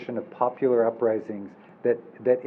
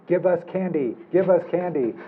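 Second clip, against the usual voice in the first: higher-pitched mock street chant; half-yelled demand, pitched up; imitated yelling; 6 semitones higher than usual. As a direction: mimic trick-or-treaters yelling in the street. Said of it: "go through the streets yelling".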